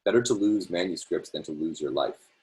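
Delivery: plain reading voice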